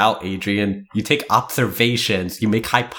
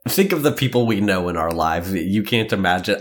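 ordinary talk, nothing marked

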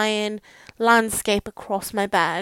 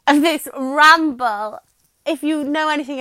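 laughing; 6 semitones higher, 6 dB louder than usual. Real, not acted. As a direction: chuckle through the phrase at herself. Laughing at her own rambling.

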